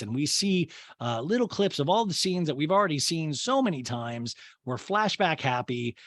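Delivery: ordinary talk, nothing marked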